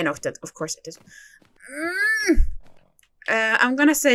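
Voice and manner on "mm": a short pained whimper. Real, not acted. Knocked the obstacle again.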